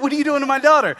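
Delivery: high, loud outraged demand. Retold or imitated. Father shouting at the nurse beating his baby's back, outraged. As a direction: shout it at her protectively. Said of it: "wanted to punch her".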